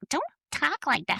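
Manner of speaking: scolding mom. Retold or imitated. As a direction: imitate a mother's high-pitched protest. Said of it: "And my mom's like".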